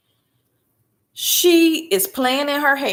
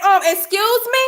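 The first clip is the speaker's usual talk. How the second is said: high, incredulous excuse me; indignant excuse me; 10 semitones higher than usual. Retold or imitated. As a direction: act offended by the request. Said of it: "I said".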